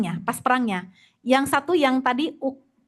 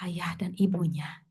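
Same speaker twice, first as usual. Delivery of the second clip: slow pointed whisper; slow whispered emphasis; soft whisper; 5 semitones lower, 7 dB quieter than usual. Real, not acted. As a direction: let it drop to a whisper. Stressing parents' teaching.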